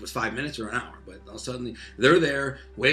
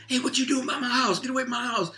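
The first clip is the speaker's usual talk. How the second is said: much higher imitation of a lady yelling across the street; 13 semitones higher than usual. Storyteller imitating a neighbor lady yelling at him off her house, high mock yell. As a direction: call out like an angry neighbor. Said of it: "I hear some lady yell".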